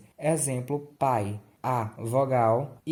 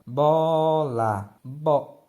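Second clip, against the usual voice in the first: BOLA with the O drawn out and loud, then BO alone; stressed O in Bola; 3 semitones higher, 5 dB louder, much slower than usual. Shown as a demonstration. Teacher showing the strongest vowel, long loud O in Bola. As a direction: stretch and punch the first syllable of Bola. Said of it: "o O é a vogal mais forte".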